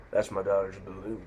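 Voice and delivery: soft menacing biker voice; cliché action-movie tough guy. Retold or imitated. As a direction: imitate a movie heavy, kept quiet. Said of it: "someone so cliche in an action movie".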